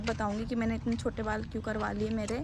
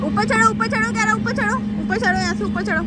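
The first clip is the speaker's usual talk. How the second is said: high loud repeated urging; loud coaxing; 10 semitones higher, 10 dB louder than usual. Real, not acted. Urging child to climb up. (बच्चे को ऊँची आवाज़ में ऊपर चढ़ने को कहना)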